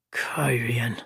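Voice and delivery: unvoiced muttered Cairn. A narrator's reading. Old gleeman to Matt, whispering Cairn. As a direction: breathe the name to himself. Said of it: "the old gleeman muttered".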